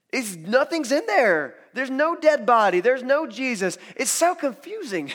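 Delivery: high, loud, excited bewilderment; astonished discovery. Acted. Ladies arriving at the empty grave, loud astonishment. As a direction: exclaim it in mounting disbelief.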